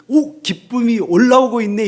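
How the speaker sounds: preacher voicing the joy welling up inside, delighted; joy bubbling up. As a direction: let the joy burst out of you. Joy rising inside, inner voice.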